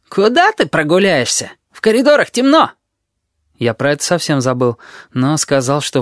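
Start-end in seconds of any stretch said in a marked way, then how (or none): 0.1-2.7 high-pitched, agitated protest; fussing Tabaki. A narrator's reading. Tabaki discourages a night walk, high fussy voice. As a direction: object in alarm, climbing higher as you go.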